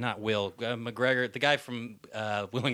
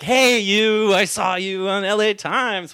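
coy; 10 semitones higher, 5 dB louder, much slower than usual. Retold or imitated. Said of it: "I tried to be coy".

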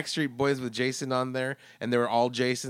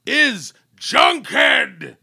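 drawn-out booming slogan shout; hammed shout; 8 semitones higher, 9 dB louder, much slower than usual. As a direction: bellow it like a wrestling announcer. Cohost shouts show slogan.

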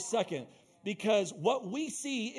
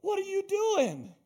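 much higher, slow disbelief; incredulous Jesus; 11 semitones higher, slower than usual. Retold or imitated. Jesus answering the disciples, high incredulous. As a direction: stretch it out in disbelief. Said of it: "And Jesus is like".

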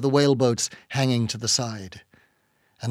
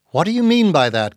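higher, louder challenge; challenging question; 5 semitones higher, 5 dB louder, faster than usual. A narrator's reading. Ishmael questioning the captain about his demand, challenging. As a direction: demand an explanation.

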